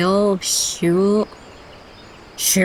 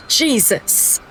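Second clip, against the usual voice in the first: sharp breathless gasp then a startled Jesus; shocked gasp; 5 semitones higher than usual. Real, not acted. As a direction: caught off guard, gasp. Shocked by the oath options.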